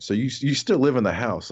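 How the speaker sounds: ordinary delivery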